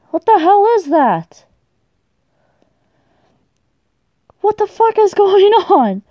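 high, incredulous outburst; alarmed bewilderment. Real, not acted. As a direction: demand answers in shocked confusion. Baffled by startling game events.

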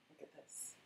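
hushed whisper, barely voiced. Real, not acted. Showing viewers the work up close, whispered. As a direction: drop to a whisper.

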